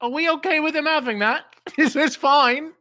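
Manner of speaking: high, anxious squeak of a worried knight; nervous high voice. Retold or imitated. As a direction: ask it in a small, high, fretful voice. Nervous opponent voiced in retelling.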